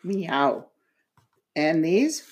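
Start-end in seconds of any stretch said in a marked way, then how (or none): 0.0-0.6 put-on soft modelled meow for a child; gentle demo meow; cat meow. Shown as a demonstration. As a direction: show the child how the cat says it. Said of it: "Cat says".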